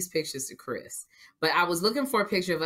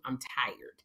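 breathy tired whisper; 4 semitones lower, 8 dB quieter than usual. Real, not acted. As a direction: drop to a whisper.

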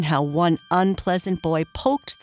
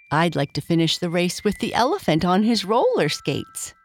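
usual speaking voice

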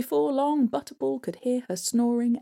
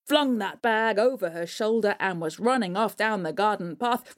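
ordinary delivery, nothing marked

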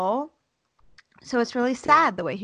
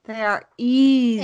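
EASY stretched out slow and loud; slow, loud stress on "easy"; emphatic agreement; 2 semitones higher, 6 dB louder than usual. Real, not acted. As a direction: lean hard on a long, drawn "easy". Drawn-out emphatic agreement with friend.

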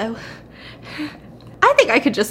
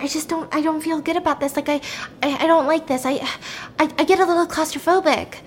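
higher, halting retold unease; anxious; 6 semitones higher, faster than usual. Valerie tells him she dislikes the cave, imitated anxiety. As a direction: imitate a nervous, stumbling protest.

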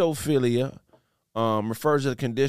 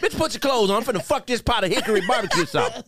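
mock horny excitement; 8 semitones higher, faster than usual. Playacting aroused by sauce.

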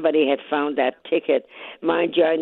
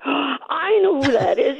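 higher, drawn-out retold realization; retold excited recognition; 7 semitones higher, slower than usual. Mailman recognizing the described customer, retold with excitement. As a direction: act out it suddenly dawning on him, high and slow.

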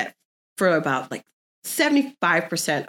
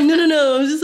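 higher, louder protest; mock exasperated; 9 semitones higher, 7 dB louder than usual. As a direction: brush him off loudly. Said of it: "I'm like".

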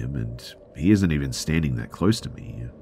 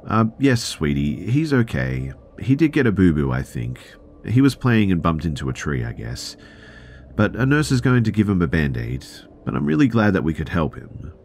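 put-on higher, lilting talk to a child; reassuring mother; 5 semitones higher, 4 dB louder than usual. Mother to little daughter, cheerful reassurance. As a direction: soothe a small child with upbeat assurance.

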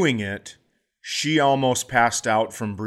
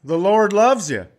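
loud, higher mock preacher voice; preachy ride operator; 7 semitones higher, 6 dB louder than usual. Operator preaches to a scared rider, hammed-up and loud. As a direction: sermonize about faith over seatbelts. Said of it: "They're like".

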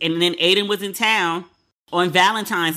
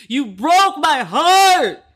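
slow, much higher and louder, hammed-up anguish; dramatic heartbreak; 8 semitones higher, 6 dB louder, much slower than usual. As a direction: wail it like a soap opera. Dramatic address to Aiden.

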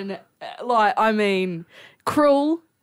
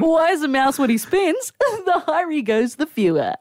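higher-pitched mock radio delivery, amused; radio demo joke; 11 semitones higher than usual. Rookie using the joke in a radio demo, hammed-up. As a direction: send up a rookie presenter telling a joke. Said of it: "use this in your demo".

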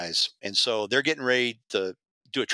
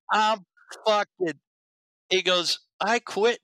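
high-pitched outraged quitting; fed-up partner; 10 semitones higher, slower than usual. Retold or imitated. Injured partner announcing he quits, in a high outraged voice. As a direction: squeak it out, done with everything.

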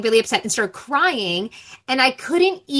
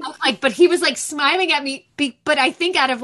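ordinary delivery

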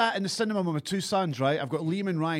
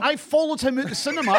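normal talking voice

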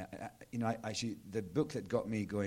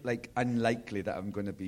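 normal talking voice

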